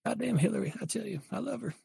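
high put-on voice, fading to a whisper; exasperated political husband. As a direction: complain fondly about the wife, high then hushed.

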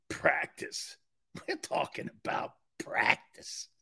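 hushed, unvoiced whisper; quoting a Philly sports legend to the audience, whispered; whispered quote. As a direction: breathe it like a famous quote. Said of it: "To quote a great Philadelphian".

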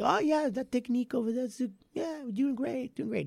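high-pitched, badly faked Brazilian accent; terrible Brazilian accent. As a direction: imitate a distracted coach in a bad Brazilian accent. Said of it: "that is my attempt at a Brazilian accent".

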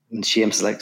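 normal talking voice

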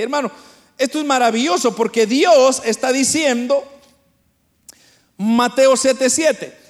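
usual speaking voice

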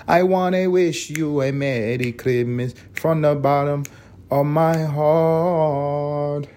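slow, sung in a hammed-up Spanish accent, dragging out the end; mock Spanish accent. Mock-singing the carol from the heart to listeners in a Spanish accent. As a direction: stretch the last words in a put-on Spanish accent. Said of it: "doing a Spanish accent with the English part".

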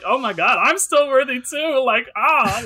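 a high, loud, near-tearful cry of joy; overwhelmed joy. Retold or imitated. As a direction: cry it out like it just hit you hard. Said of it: "I was like".

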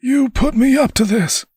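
high, strained angry cry; angry shouting. A narrator's reading. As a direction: accuse in a high-pitched shout. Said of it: "he was shouting".

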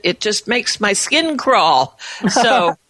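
normal talking voice

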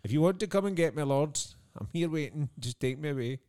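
high, loud plea to the heavens; mock rapture. Fan telling the Lord he's ready to go, mock rapturous plea. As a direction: call up to the Lord, hammed-up and high. Acting out being happy to die.

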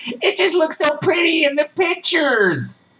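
put-on sarcastic ditzy girl; mocking airhead. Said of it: "'cause that's a good idea".

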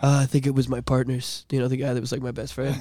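low, very soft, fading away; deadpan and drained, trailing to near silence; numb acted confession. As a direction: play the shell-shocked husband, hushed. Traumatised paramedic answers wife.

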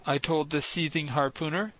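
ordinary delivery

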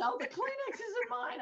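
high-pitched mock sobbing agent; squeaky, put-on tearful voice; retold tearful protest. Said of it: "she was still sobbing".

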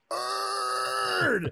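a sudden high shouted request; loud yell. Retold or imitated. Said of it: "So I yelled really loud".

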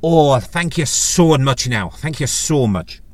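put-on drawn-out Irish-accented thanks. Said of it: "Apologies for the Irish accent".

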